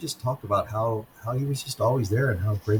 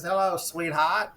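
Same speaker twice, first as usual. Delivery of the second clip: slow, higher, hammed-up old charmer; 8 semitones higher, slower than usual. Old veteran greeting a girl at the bar, mock charming. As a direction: play the old soldier sweet-talking a pretty girl. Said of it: "grabs her and sticks him on his leg and says".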